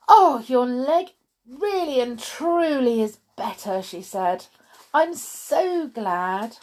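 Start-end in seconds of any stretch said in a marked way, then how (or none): 0.1-3.8 high, loud burst of delight. A narrator's reading. Child delighted at friend's recovery. 4.9-6.6 high, drawn-out gladness; delighted. A narrator's reading. Child delighted at friend's recovery.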